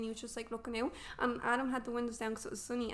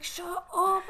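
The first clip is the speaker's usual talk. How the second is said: higher, louder snapped shut up; retold snap; 8 semitones higher, 6 dB louder than usual. Snapping at her mum between contractions, higher and louder. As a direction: bark it back at mum mid-labour.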